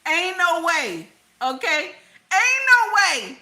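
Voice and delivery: high, loud, emphatic refusal to believe it; loud disbelief. Acted. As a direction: belt it out, utterly incredulous. Reacting in disbelief to story.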